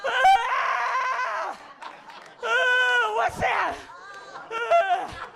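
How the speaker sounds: high loud wonder-struck shouts; a baby mid acid trip, amazed; tripping baby. Retold or imitated. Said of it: "they look like they're mid-peak".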